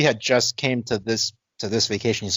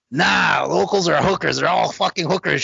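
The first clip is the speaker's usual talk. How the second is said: put-on higher, shouted drunk retort; drunk insult; 7 semitones higher than usual. Drunk guy shouting at the girl by the cab, higher and rough. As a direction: yell it like a belligerent drunk.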